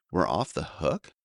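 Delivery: slow, bewildered question; slow confused repeat. Retold or imitated. Boy repeating the news, slow and confused. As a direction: drag it out, unable to grasp it. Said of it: "I repeated slowly, still totally confused".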